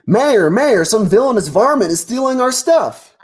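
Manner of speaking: high, urgent cartoon deer calling for his friend; upset deer. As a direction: alarm a friend about a theft. Animals call to mayor.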